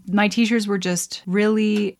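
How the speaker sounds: normal talking voice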